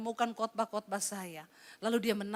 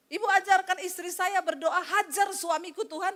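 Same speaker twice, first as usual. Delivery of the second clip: angry husband; 7 semitones higher, 4 dB louder than usual. Retold or imitated. Angry husband calls the pastor.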